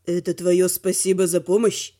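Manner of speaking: low male voice; cool, low-pitched challenge; goading dragon. A narrator's reading. Velor goads the heroine.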